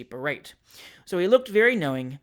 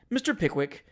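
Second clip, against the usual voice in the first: ordinary delivery, nothing marked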